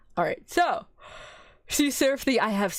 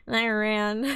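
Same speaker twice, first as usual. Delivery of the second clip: usual speaking voice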